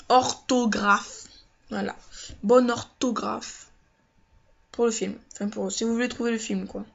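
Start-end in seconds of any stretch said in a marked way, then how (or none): 0.1-1.3 "Orthographe" drawn out very slowly and louder, each syllable clear; slow, loud, spelled-out "Orthographe"; slow emphatic word. Shown as a demonstration. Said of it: "la bonne orthographe". 2.4-3.7 "Bonne orthographe" slow and louder, stress on "bonne"; slow, firm, insisting on "Bonne orthographe"; slow emphatic repeat. Shown as a demonstration. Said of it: "la bonne orthographe".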